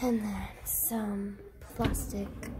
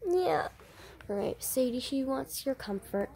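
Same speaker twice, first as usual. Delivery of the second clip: normal talking voice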